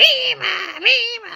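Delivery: high chirpy bird call; high mimicked birdsong; bird call imitation. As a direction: sing it like a little bird. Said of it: "some bird or something would go".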